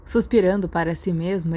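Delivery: ordinary delivery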